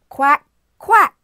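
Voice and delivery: loud, high duck imitation; duck quack. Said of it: "What sound does a duck make?".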